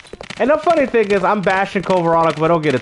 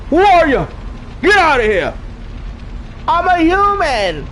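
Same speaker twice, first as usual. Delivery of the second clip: re-enacted standoff, raised high voice; urgent shout; 8 semitones higher than usual. Streamer acting out the shotgun scene for Zed, urgent shouting. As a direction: shout it like a startled game character. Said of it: "when the guy pulls out his shotgun and he's like".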